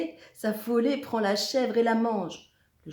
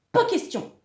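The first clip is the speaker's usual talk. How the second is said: very high, loud burst; indignant monster yelp; outraged refusal; 13 semitones higher, 8 dB louder than usual. A narrator's reading. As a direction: snap back with a high shout.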